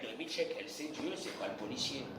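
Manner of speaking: soft, low mock resignation; resigned murmur. Rabbi voicing the believer accepting the fine, quiet resigned murmur. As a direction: let it fall away quietly, accepting.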